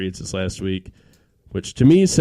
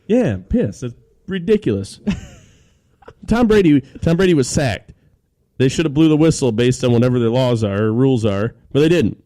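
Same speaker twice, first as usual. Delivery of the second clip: angry rant; 3 semitones higher, 5 dB louder than usual. Real, not acted. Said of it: "That's, that's my rant".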